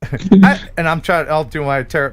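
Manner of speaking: normal talking voice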